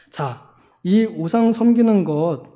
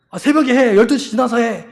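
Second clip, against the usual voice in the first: bossy nagging; 4 semitones higher, 4 dB louder than usual. Retold or imitated. Zealot lecturing neighbor.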